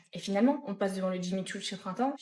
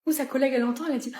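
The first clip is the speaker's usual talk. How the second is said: ordinary delivery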